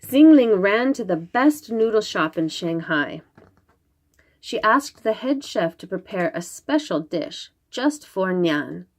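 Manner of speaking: ordinary delivery, nothing marked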